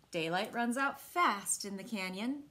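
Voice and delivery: plain reading voice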